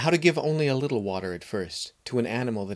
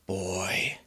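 horse whispering; 2 semitones lower than usual. Acted. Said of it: "whispered the horse".

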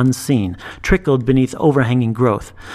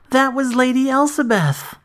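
put-on alarmed girl; 12 semitones higher than usual. A narrator's reading.

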